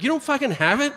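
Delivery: high-pitched disbelieving question; mock incredulous. Retold reaction to empty-handed dealer.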